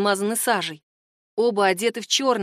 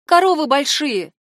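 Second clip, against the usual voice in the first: louder, higher comeback; sharp retort; 5 semitones higher, 6 dB louder than usual. A narrator's reading. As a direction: fire back with raised voice. Katya retorts to prying boy.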